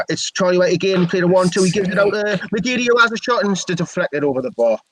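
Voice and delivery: lifted pitch as the shot comes; excited commentary. Real, not acted. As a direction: call the attack with rising urgency. Live commentary on a shot at goal.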